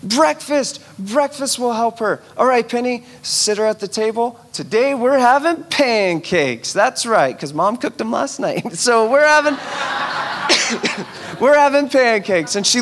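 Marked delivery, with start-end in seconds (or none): 0.0-6.7 cheery dad. Retold or imitated. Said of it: "I'm like".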